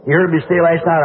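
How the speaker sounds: ordinary talk, nothing marked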